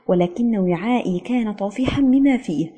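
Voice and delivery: plain reading voice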